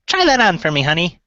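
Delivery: high-pitched gnome woman. Acted. Gnome seamstress handing over a new glove, high gnome voice. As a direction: lift it high into a friendly gnome voice. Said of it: "let me get my gnome voice on".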